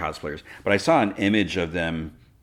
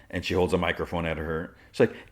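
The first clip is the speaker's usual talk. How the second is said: normal talking voice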